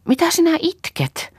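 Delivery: very high, puzzled question; high-pitched bewilderment; astonished. A narrator's reading.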